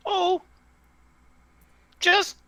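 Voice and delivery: very high, halting little voice; small tinny voice. Acted. Tree voice caught off guard by a question, tinny. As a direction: hesitate in a tiny high voice. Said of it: "tinny voice comes from the tree".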